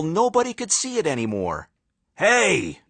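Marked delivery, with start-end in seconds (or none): 2.2-2.8 high shouted call. Acted. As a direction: call out from the stands. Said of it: "shouted a fan".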